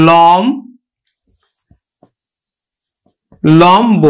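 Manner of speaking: লম-, then লম্ব with the ব sounded; syllable by syllable. Shown as a demonstration. Teacher sounding out লম্ব for students. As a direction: sound out লম্ব in two steps. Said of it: "যেমন উচ্চারণ হবে কিন্তু".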